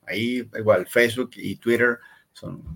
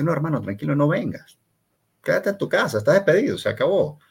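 mock-friendly boss, higher, ending in a firing; sarcastic boss; 5 semitones higher than usual. Imitated boss answering the hungover employee, sarcastic and higher. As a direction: play the jefe, sweetly sarcastic, then firing him.

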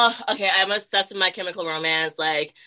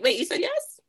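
much higher, squeaky with disbelief; disbelieving surprise; 13 semitones higher than usual. Retold or imitated. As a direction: act amazed someone agreed. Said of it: "sometimes I'm like".

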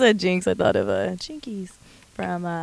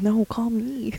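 mock whisper; 3 semitones higher, 3 dB quieter, much slower than usual. Joking whisper to Zac.

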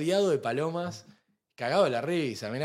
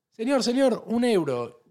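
high, louder mock vendor call; street seller call; 9 semitones higher, 4 dB louder, slower than usual. Seller in Venice hawks birdseed, lightly mocked call. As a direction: call out to a tourist like a pushy seller.

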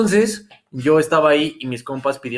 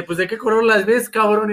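quoted friend; 8 semitones higher than usual. Retold or imitated. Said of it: "Me dice".

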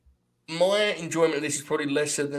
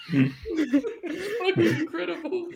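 laughing through words; 14 semitones higher, much slower than usual. Real, not acted. Still laughing after impression.

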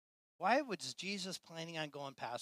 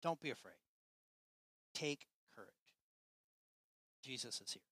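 softer, quiet sincere comfort; tender reassurance; 8 dB quieter than usual. Real, not acted. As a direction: speak it gently to the church. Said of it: "I just want to tell you, church".

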